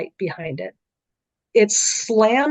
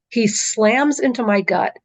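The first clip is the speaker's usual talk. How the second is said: usual speaking voice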